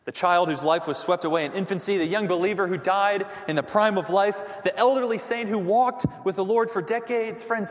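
normal talking voice

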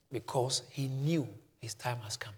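hushed whisper for emphasis. Real, not acted. Preacher whispering to the congregation, a soft aside. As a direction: drop to a breathy secret. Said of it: "unvoiced whisper".

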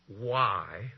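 ordinary delivery

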